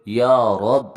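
ইয়া loud with a long held "aa", রব cut at the final b; pronunciation demo. Shown as a demonstration. Teacher explaining how it was read, ইয়া রব in a loud demo. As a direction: stretch ইয়া, stop রব on a vowelless b. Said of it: "আমি আবার কীভাবে পড়েছি".

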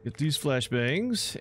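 normal talking voice

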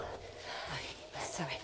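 hushed unvoiced aside; a quick whispered aside to a neighbour. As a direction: whisper it under the breath. Aside while host panics.

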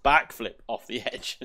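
words through loud laughter; laughing. Real, not acted. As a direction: laugh while describing it. Laughing at own character's backflip.

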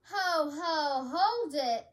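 loud elf's halt, drawn out; loud storybook cry. A narrator's reading. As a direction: call out firmly to stop someone. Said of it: "cried the elf".

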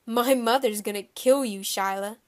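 emphatic scolding; loud warning. Acted. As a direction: warn her forcefully, loudly. Boy threatens Shyla.

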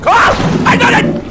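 high, loud frantic cry; panicked scream. Real, not acted. Panic, thinks they will die.

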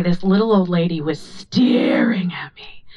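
hushed conspiratorial whisper. Real, not acted. Telling the crowd about the staring lady, whispered. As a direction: drop to a secret whisper.

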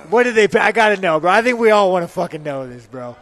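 loud, pushing for the answer, bro; excited demand. Real, not acted. Host badgers the fighter about UFC pay, loudly excited.

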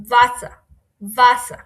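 "ভাসা" loud, opening on a v sound. Shown as a demonstration. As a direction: model "ভাসা" as "va-sa". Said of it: "ভেটা হচ্ছে ভয়ের মতো উচ্চারণ".

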